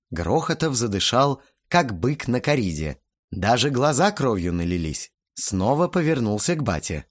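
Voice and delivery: usual speaking voice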